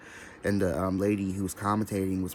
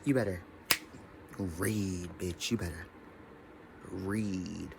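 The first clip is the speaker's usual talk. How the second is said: quiet sassy taunt; 7 dB quieter, slower than usual. Pretend. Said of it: "hold on".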